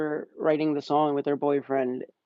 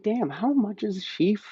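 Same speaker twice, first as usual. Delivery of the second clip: stunned reaction; 5 semitones higher than usual. Retold or imitated. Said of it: "I'm like".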